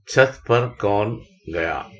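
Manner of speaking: छत पर कौन गया read slowly, word by word; teacher reads slowly for the class; slow reading aloud. Shown as a demonstration. Teacher reading a practice line to students slowly. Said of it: "reading practice sentence".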